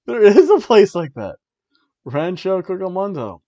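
retold amazement. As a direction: burst out as if just discovering it. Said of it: "I'm like".

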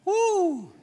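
high, exultant preacher's "woo"; joyful whoop. Real, not acted. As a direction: let out a celebrating whoop.

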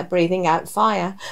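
usual speaking voice

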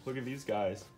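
ordinary talk, nothing marked

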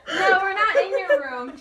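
very loud, high, laughing to tears; helpless laughter; laugh-crying. Real, not acted. Actor in fits of laughter on set.

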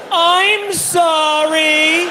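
high, very slow, drawn-out "I'm sorry" cry; yelled apology. Retold or imitated. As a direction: wail the apology in embarrassment. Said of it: "So I yelled".

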